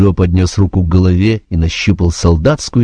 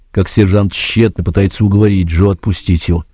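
usual speaking voice

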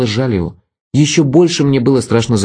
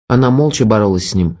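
usual speaking voice

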